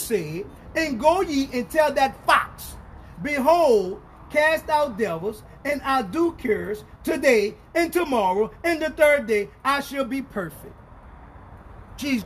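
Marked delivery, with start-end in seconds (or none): 0.7-10.7 preacher declaiming scripture in bursts; emphatic pulpit reading; forceful scripture reading. Real, not acted. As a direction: proclaim each phrase of the verse with weight. Reads scripture aloud at force.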